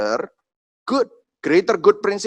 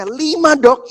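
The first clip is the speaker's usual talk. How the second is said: alarmed higher-pitched query; startled question; 9 semitones higher, 3 dB louder, much slower than usual. Retold or imitated. Father asking the doctor about five shots, startled. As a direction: blurt it out higher, taken aback. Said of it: "waktu saya ngomong sama dokternya".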